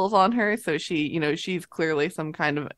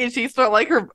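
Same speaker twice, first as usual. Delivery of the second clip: usual speaking voice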